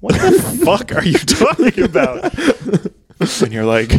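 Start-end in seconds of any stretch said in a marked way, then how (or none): 0.0-2.3 high-pitched mock disbelief; comic incredulous outburst, pitched up; baffled prisoners. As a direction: react as if utterly bewildered. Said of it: "other prisoners are like".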